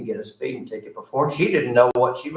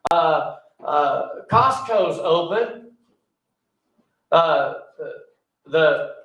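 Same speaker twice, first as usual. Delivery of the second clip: preaching; 4 semitones higher than usual. Acted. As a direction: press the point to the congregation. Preaching on churches suing.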